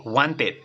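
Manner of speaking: "wanted" ending in a clear E; wrong spelling pronunciation. Shown as a demonstration. Teacher repeats the mistake to avoid, mispronounced. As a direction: pronounce the E of "wanted" as spelt. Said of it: "así con E".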